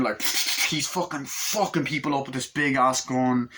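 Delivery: usual speaking voice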